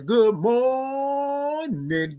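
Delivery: very slow, high stretched "Good morning"; signature greeting. Shown as a demonstration. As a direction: hold "Good morning" out high like a call to the room. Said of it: "we like to say good morning".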